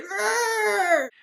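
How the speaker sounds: high, strained angry roar; mock furious "arr"; angry grandpa growl. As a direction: roar like a cranky old man. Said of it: "Grandpa's just angry".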